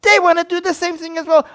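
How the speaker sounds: loud, high, insistent outburst; yelling. Real, not acted. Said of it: "I need to yell at you guys".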